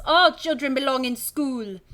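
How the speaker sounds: stern French schoolmistress greeting the class; French accent. Acted. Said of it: "She's French as well".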